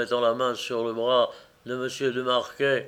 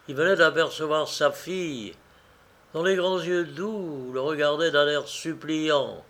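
usual speaking voice

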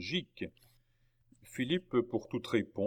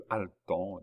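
ordinary delivery

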